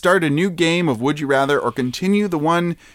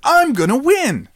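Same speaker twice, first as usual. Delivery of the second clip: put-on high cute anime girl; 9 semitones higher, 3 dB louder than usual. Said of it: "Do your best anime voice".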